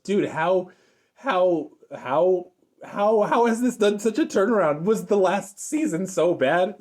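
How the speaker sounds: high-pitched, stammering amazement; amazed disbelief. Real, not acted. Reviewer marvelling at the show's turnaround, amazed disbelief. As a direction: repeat "how" in real astonished disbelief.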